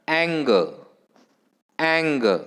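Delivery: "anger" with a strong ANG, falling away; stress on first syllable. Shown as a demonstration. Teacher presenting next word, "anger" stressed on ANG. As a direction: hit ANG in "anger", let the ending drop. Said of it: "stress is on the first syllable".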